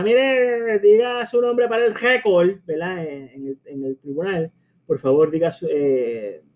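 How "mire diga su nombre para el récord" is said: a lightly higher, formal lawyer's request. Retold or imitated. Lawyer asking a witness to state their name, formally. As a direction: address the witness like counsel in court. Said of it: "si el abogado le pregunta".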